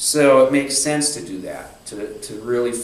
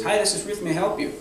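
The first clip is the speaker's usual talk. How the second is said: bright, higher, polite receptionist greeting; Ruth's phone greeting; 8 semitones higher, faster than usual. Retold or imitated. Ruth answering the office phone, in a lightly voiced cheery greeting. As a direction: answer the phone sweetly like a busy office worker.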